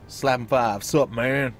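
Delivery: a loud, casual dude greeting; half-hearted mock bro voice; joking dialogue option. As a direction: throw it out like a bro.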